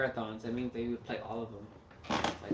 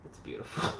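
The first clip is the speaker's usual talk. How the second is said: ordinary talk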